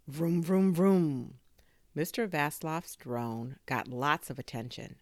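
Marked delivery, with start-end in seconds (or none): 0.1-1.4 loud, raised "vroom" engine imitation; race car engine noise. A narrator's reading. Drone flying off like a race car, loud revving. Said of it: "sounded like a race car".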